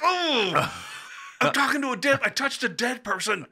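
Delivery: high-pitched, hammed-up horror; mock horrified realization. Realizing they spoke to a dead person, mock horrified.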